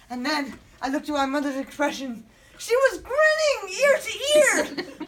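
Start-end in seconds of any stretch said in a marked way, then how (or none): 2.6-5.1 high, loud, drawn-out disbelief; appalled exclamation. Acted. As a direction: wail it slowly at high pitch. Horrified at mother's glee.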